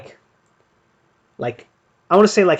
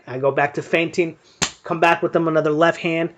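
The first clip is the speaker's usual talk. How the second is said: usual speaking voice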